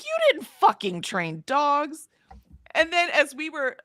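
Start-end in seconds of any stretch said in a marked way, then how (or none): 0.0-2.1 slow, higher, every word hit; retelling the thought, slow and indignant. Retold or imitated. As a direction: spit it out slowly in disbelief. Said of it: "in my brain, I'm like".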